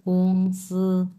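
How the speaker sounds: গংসি held level on both syllables, very slowly; level first tones. Shown as a demonstration. Showing students how গংসি sounds, slowly. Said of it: "দুটাই এক number tone".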